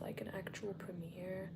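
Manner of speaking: hushed whisper. Real, not acted. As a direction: whisper so the call can't hear. Whispers while others on Zoom.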